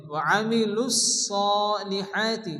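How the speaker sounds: slow আমিলুস সলিহাতি, lus linked into a heavy long so; drawn-out সলিহাতি joined to আমিলুস; joined sad. Shown as a demonstration. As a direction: hold the doubled s in আমিলুস সলিহাতি. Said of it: "সদের সঙ্গে যুক্ত হবে".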